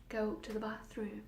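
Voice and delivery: soft, coaxing voice in the head; hushed inner voice. Acted. Voices in her head order the girl upstairs, hushed. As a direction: speak softly, like a voice inside her.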